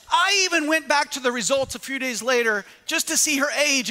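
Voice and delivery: ordinary talk, nothing marked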